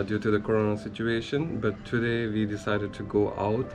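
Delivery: ordinary talk, nothing marked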